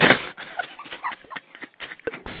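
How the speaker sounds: real burst of breathy, unvoiced laughter. Real, not acted. Interview pair reacting to the church anecdote, laughing. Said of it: "I'm sorry for laughing".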